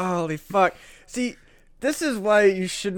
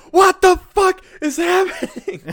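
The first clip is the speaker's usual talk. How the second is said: high, slow, laughing yell of disbelief; mock baffled shout; 11 semitones higher, 5 dB louder than usual. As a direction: howl in disbelief at the universe. Mock baffled brain reaction.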